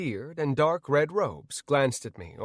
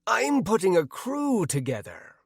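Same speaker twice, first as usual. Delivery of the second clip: higher-pitched pirate character voice; put-on pirate voice, pitched up; 7 semitones higher than usual. A narrator's reading. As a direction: recruit like a pirate hawking for crew. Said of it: "a hawkish-looking pirate said to me".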